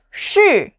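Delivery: "Shi," from high straight down; fourth tone. Shown as a demonstration. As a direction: drop "Shi," short and sharp. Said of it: "The fourth tone drops fast".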